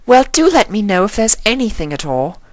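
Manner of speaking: high, elegant lady voice; Rarity's voice. A narrator's reading. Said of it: "said Rarity".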